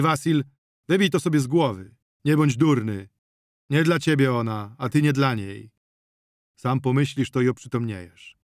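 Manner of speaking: loud, authoritative scolding; old father laying down orders; stern command. A narrator's reading. Old Prokop orders the family.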